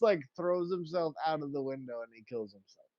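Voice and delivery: normal talking voice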